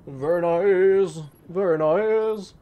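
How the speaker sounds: high, loud, slow hype voice; hyped up. Pretend. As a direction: drag it out like a hype announcer. Hyped mock shoutout.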